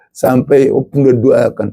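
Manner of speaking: usual speaking voice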